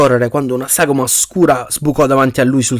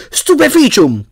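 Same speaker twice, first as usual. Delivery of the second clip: high-pitched incantation cry; yelled spell; 12 semitones higher, 4 dB louder, slower than usual. A narrator's reading. Cedric joins the fight against the spider, yelling. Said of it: "Udì Cedric urlare a sua volta".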